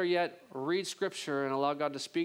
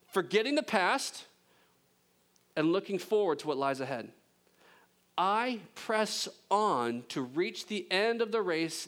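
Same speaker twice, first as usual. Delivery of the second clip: preaching; 7 semitones higher than usual. Acted. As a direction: proclaim it from the pulpit. Reads Paul's words with force.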